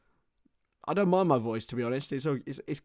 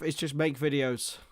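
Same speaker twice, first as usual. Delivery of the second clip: ordinary delivery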